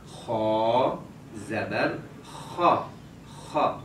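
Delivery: خا spelled very slowly with a heavy, full kha. Shown as a demonstration. Teacher shows the child the heavy خا. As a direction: hold خا heavy and slow. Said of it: "خا کی آواز موٹی ہونی چاہیے".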